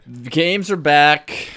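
slow, loud proclamation; grand announcement. Acted. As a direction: announce it like a trailer.

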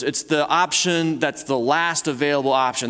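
ordinary delivery

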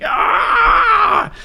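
a sudden piercing high scream; scream of agony. Retold or imitated. Storyteller voicing a tortured soul: high agonized scream. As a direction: howl out like someone in agony in the afterlife. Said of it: "people are screaming in agony".

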